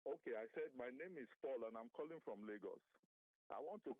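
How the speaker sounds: very faint caller on a bad line; weak, distant phone voice; barely audible. Real, not acted. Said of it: "but your voice is very faint".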